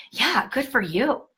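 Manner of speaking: a slow, soft, lightly voiced older man; hint of an approving older stranger; imitating older man. Retelling a sixty-five-year-old man's approval in a mild mock voice.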